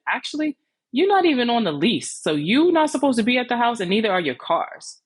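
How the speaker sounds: high, sharp comeback that climbs up; heated putdown. Retold or imitated. Putting the tenant in her place.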